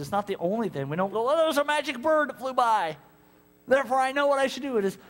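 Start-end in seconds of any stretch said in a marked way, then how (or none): 1.2-4.8 higher, silly mock reasoning; high silly mock naive voice. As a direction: play it ridiculous. Mocking magic-bird reasoning.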